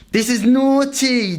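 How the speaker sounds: high, slow, drawn-out swagger; mock smug boast. Showing viewers his physique, slow smug swagger. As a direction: savour every syllable of naughty.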